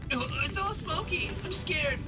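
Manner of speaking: put-on high, frightened little kid; scared child voice. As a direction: whimper through the smoke. Children and matron in smoke.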